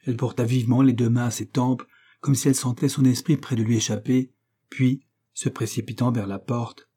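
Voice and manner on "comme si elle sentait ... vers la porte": ordinary delivery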